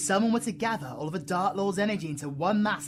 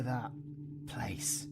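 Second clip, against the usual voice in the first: hushed dread-filled whisper; 3 semitones lower, 7 dB quieter than usual. Acted. V telling El about the dark place, whispering. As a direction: breathe the words out softly.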